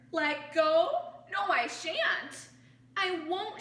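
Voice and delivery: loud, high, slow refusal; frightened shout. Acted. Kevin refusing the crowd, loud and scared. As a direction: refuse in a panic. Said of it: "shouted Kevin".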